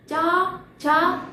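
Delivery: चा, छा dragged out very slowly as if from the throat; wrong throat pronunciation. Shown as a demonstration. Teacher showing students the wrong throat sound, very slow. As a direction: stretch चा, छा slowly, deliberately wrong. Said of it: "ये तो नहीं होता".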